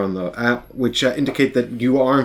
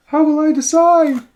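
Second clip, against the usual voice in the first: mock dramatic wail; 6 dB louder, slower than usual.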